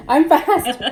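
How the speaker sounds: laughing as she says it. Real, not acted. Cutting potatoes for her daughter, laughing. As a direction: laugh through a proud little boast.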